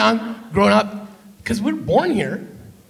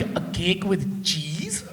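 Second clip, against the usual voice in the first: put-on slow, softer Punjabi-accented auntie; 4 semitones lower, 7 dB quieter, much slower than usual. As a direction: puzzle over it slowly in a Punjabi accent. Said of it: "The other auntie's like".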